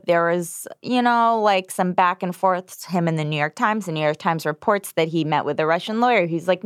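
usual speaking voice

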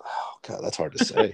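whispered, hushed aside; whispering. Real, not acted. Aside after hot take.

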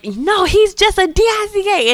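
a kid saying a bad word; kid blurting out. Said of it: "I was like".

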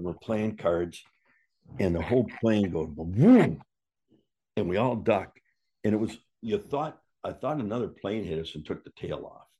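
normal talking voice

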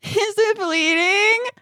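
put-on high, slow, tongue-out child voice; little girl with tongue out. Mom imitating her crying little daughter with tongue out. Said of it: "she sticks her tongue out and goes".

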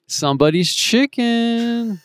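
mock odd, slow, high voice for his roommate; imitating Tommy. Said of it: "And then he's like".

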